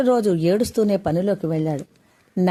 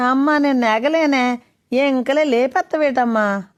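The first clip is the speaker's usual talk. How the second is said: put-on whiny child; 6 semitones higher, 4 dB louder than usual. A narrator's reading. Sleepy boy complaining to mother.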